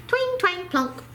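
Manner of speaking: high, plucky banjo noises; banjo plinks. A narrator's reading. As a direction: pluck out each sound like strings. Banjo sounds.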